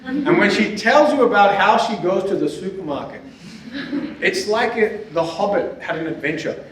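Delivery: usual speaking voice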